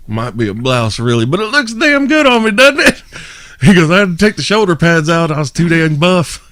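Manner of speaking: high, loud cocky brag; cocky comedian. Retold or imitated. The comedian shows off his blouse, high, loud and cocky. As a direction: strut in the blouse.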